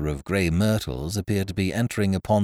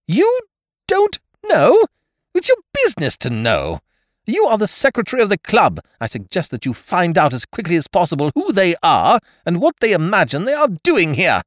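high, loud commanding lady voice; imperious lady; 13 semitones higher, 8 dB louder than usual. Acted. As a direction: boss him about from on high. Miss Ukridge scolds the secretary.

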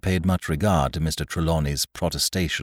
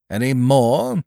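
Trelawney; 9 semitones higher, 8 dB louder, slower than usual. A narrator's reading. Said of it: "asked Mister Trelawney".

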